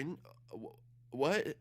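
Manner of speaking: faint stammer jumping to a high question; flustered shock. Retold or imitated. Babysitter confronted about her boyfriend, flustered shock. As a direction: imitate being caught out and lost for words.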